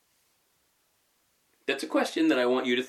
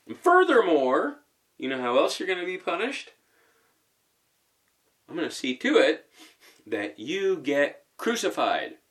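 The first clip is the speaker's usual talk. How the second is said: louder, higher hammed-up threat; mock escalating threat; 6 semitones higher, 3 dB louder than usual. Jesus mock-threatening Peter, hammed-up and louder. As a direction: pile on the punishment with mock relish. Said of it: "I'm gonna say this facetiously".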